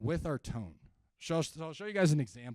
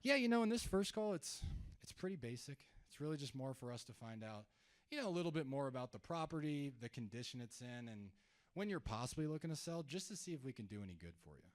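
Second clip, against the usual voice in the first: downplayed; 6 dB quieter than usual. Shown as a demonstration. Agent downplaying a first call, softer and casual. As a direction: make it sound like no big deal. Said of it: "the way that that sounds".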